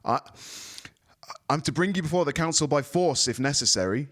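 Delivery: envoy threatening force despite fear; false confidence. A narrator's reading. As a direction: bluff confidence over fear. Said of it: "sounding a whole lot more confident than he looked".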